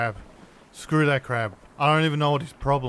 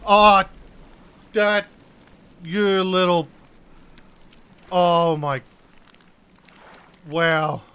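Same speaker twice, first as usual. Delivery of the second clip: loud, high, broken-off exasperated cries; frustrated outburst; 5 semitones higher, 8 dB louder, slower than usual. Real, not acted. Sputter in loud frustration. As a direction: gamer hit by demon crabs, loud frustrated sputtering. Crabs attack him again, outburst.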